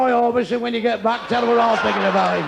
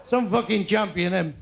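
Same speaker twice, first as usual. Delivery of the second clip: ordinary delivery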